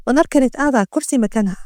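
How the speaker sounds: ordinary delivery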